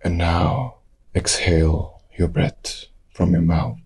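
ordinary delivery

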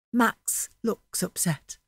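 ordinary delivery

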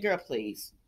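put-on low, soft brush-off of Girl, please; dismissive girl please. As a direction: say Girl, please low and quiet, waving it away. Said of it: "And I said".

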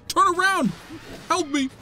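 high-pitched urgent call. Real, not acted. Player to companion in battle, high urgent call. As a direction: call for help mid-fight.

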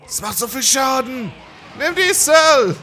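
very high, loud battle cry; triumphant shout. Real, not acted. Gamer hitting back at Cell, triumphant shout. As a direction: yell it as you strike back.

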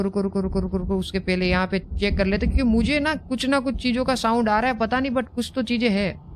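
ordinary talk

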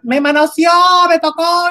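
a woman screaming accusations, mimicked loud and high; imitated yelling. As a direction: shout it like she's crying for help. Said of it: "empezó a gritar".

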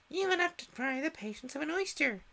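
high, cheeky retort; snappish young crab. A narrator's reading. Young crab sassing her mother, high and snappish. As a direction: sass her back. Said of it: "a little snappishly".

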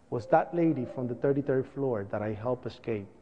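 usual speaking voice

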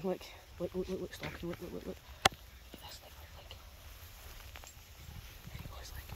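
softly urging to watch, barely voiced; hushed whisper. Real, not acted. To viewers, watching the dog stalk, a soft whisper. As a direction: keep it hushed so the dog stays focused. Not disturbing the dog hunting.

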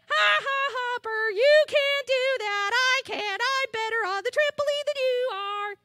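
hammed-up teasing of a friend who can't do it; mean taunt. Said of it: "do you think that's what Murray did".

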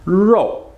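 Rou opened wide then closing to small lips; mouth big then small. Shown as a demonstration. Teacher shows how the mouth moves on Rou. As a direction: start Rou open and round it small. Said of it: "make that mouth big, then small".

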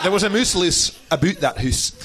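playful Scots rhyme with oo vowels; jokey broad Scottish; Scottish accent. Shown as a demonstration. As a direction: roll out the rhyme in a Scottish brogue. Continues the Scottish pronunciation joke.

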